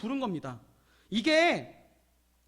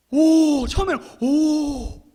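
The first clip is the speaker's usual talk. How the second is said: drawn-out, raised cries of awe; amazed; 5 semitones higher, 6 dB louder, much slower than usual. Acted. Preacher acting out Israelites seeing the miracle, amazed. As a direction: gasp "oh" in stunned wonder. Israelites' first astonished reaction, acted.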